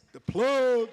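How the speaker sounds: loud, high whoop of the name; excited shout. Real, not acted. Cheering on Latasha's company from the stage, with a loud high shout.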